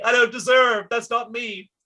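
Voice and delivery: high squeaky voice of resistance; mock freaking out. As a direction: play the body parts panicking in protest. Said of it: "They're freaking out and saying".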